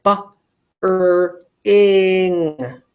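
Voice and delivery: segmented phonics demo; stretched into sounds. Shown as a demonstration. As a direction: bring split slowly as "Ba, er, ing". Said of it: "Let me stretch out the word bring in all of its sounds".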